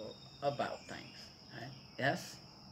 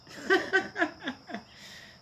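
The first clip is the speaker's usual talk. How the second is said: high laugh. Real, not acted. Laughs at own remark.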